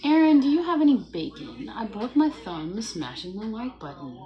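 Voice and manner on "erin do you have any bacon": high, loud put-on voice asking; viewer's voice. Reading a viewer's chat question in a high loud put-on voice. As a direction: play the eager viewer begging for bacon.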